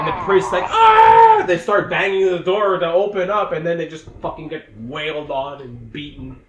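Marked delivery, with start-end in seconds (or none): 0.7-1.4 loud, high mock scream; full-volume comic shriek; panicked scream. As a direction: scream like a terrified priest. Imitates the priest's scream.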